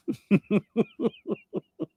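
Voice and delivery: long high-pitched giggle; hard laughing. Real, not acted. Host cracking up at jokes in own head, hard laughing. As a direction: laugh hard in a run of high little bursts. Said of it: "That's why I'm laughing so hard".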